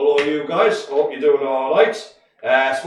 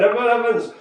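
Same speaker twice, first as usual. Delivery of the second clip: a quicker, lighter Welsh lilt on Emma Evans; light Welsh accent; 6 semitones higher than usual. Pretend. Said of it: "I have to say Emma Evans in a Welsh accent".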